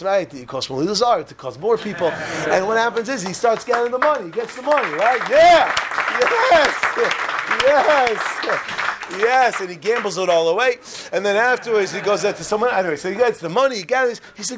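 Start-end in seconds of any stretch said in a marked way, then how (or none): 5.3-9.6 loud, high yelled yeses; triumphant cheering. Acted. Storyteller celebrating the money coming in, jubilant shouts. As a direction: cheer each word as its own burst.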